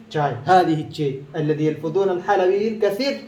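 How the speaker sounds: normal talking voice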